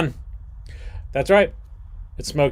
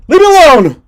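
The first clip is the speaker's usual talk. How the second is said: high, loud hammed-up cry; overacted sob-shout of leave me alone; dramatic mock wail; 17 dB louder than usual. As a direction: throw a melodramatic tantrum. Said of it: "this is gonna be dramatic".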